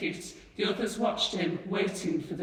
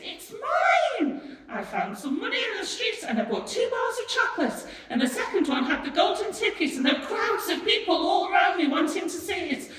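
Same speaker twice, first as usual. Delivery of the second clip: high, loud, breakneck outpouring; hurricane of excitement; 12 semitones higher, 5 dB louder than usual. A narrator's reading. Charlie tells his mother of the golden ticket, excited yelling. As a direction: burst out with the news, voice leaping up. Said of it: "rushing in on them like a hurricane".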